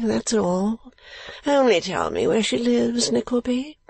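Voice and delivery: put-on high, drawling silly young lord; foolish lordly dupe, eager. Client begging Nickleby for her address, high eager drawl. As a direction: plead in a high foppish drawl.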